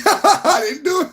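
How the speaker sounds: high, giggling "I didn't do it"; denying with a giggle; laughing denial. Real, not acted. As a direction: laugh through a playful denial.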